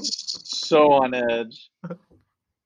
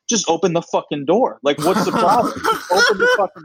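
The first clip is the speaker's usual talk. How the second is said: imitating an irate passenger, rising into a high yelp at the end; hammed-up demand that climbs higher and louder; mock angry yell; 8 semitones higher, 3 dB louder than usual. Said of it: "he's yelling at the stewardess".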